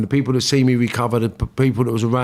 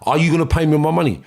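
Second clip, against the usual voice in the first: louder and higher, pushing hard for the cash; aggressive demand; 5 semitones higher, 4 dB louder than usual. Retold or imitated. Debt collector shouting at the man who owes him money, loud and aggressive. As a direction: get in his face about the money, speeding up at the end. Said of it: "I said".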